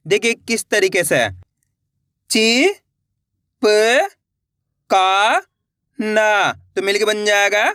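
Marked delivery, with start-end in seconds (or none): 2.3-6.5 ची, प, का drawn out and rising, ना falling to close; syllable by syllable. Shown as a demonstration. Teacher reading the vowel sign directly, syllable by syllable. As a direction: sound out ची, प, का, ना singly. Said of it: "direct मात्रा का उच्चारण करके पढ़ेंगे".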